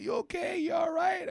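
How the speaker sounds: high, loud concerned voice; hammed-up worried friends; mock worried friends. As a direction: call out like anxious friends checking in. Voicing worried friends texting.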